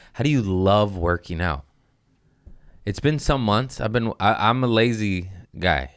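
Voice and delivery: ordinary delivery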